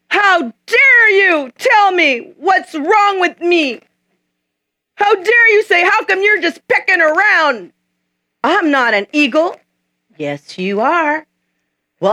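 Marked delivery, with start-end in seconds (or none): 0.1-9.6 high, slow, drawn-out offended cry; mock-offended eaglet, higher and louder; indignant outrage. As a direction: act scandalized and deeply insulted.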